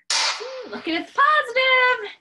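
mock cheerful. Real, not acted. Sarcastic high cheer.

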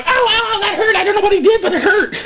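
high, wailing mock pain; hammed-up howling complaint; hurt and acting weird. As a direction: whine as if badly wounded. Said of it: "acting weird and grunting around going".

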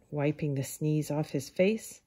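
usual speaking voice

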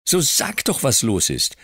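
put-on high, pressing boy's plea; anxious little boy urging; urgent child. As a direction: push her to tell, frightened. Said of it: "drängte ich Peggotty und blickte sie ängstlich an".

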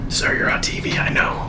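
soft whispered encouragement; whispering. Real, not acted. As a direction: whisper it to cheer them on. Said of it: "Why are you whispering".

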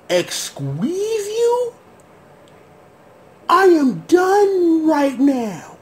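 high, slow, fed-up disbelief; stunned exasperation. Real, not acted. Viewer giving up on the plot, high slow exasperation. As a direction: sound completely done. Reacting to the twist.

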